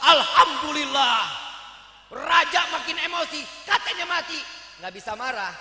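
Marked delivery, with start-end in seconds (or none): 0.1-1.5 loud slow jubilant shout; triumphant cry. Acted. Abu Nawas jumps up alive. 2.1-4.4 louder, higher outrage; indignant. Acted. King angry at trick.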